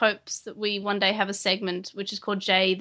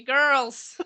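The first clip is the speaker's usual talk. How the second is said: mock Irish; 5 semitones higher than usual. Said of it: "and they say".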